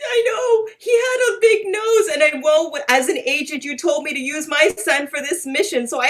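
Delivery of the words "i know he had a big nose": high, crying wail; hammed-up crying shout; mock wailing. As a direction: overdo the tearful confession. Agent wailing confession.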